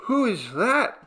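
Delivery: high, startled question; startled cry. A narrator's reading. Radoshka to a stranger creeping up, high startled cry. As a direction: cry out in alarm, pitch up. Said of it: "cried Radoshka".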